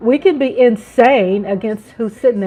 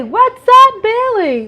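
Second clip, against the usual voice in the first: excited greeting; 11 semitones higher, 5 dB louder, slower than usual. Real, not acted. Greeting a chat viewer.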